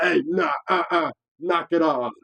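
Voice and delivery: loud, high, slow command; drawn-out mock scolding; retold telling-off. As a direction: call out sharply to break it up. Retold order to stop.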